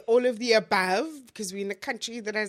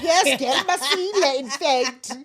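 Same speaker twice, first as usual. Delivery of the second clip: excited agreement; 6 semitones higher, 8 dB louder, slower than usual. Retold or imitated. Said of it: "And I'm like".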